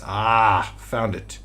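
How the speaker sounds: ordinary talk, nothing marked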